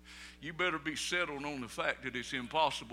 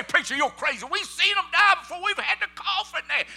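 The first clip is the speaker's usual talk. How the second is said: much higher, much louder mock protest; imagined doubter shouting; 13 semitones higher, 10 dB louder than usual. Imagined doubter shouting down the preacher about death, high loud protest. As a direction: shout it back like a scoffer calling the preacher crazy. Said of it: "You say".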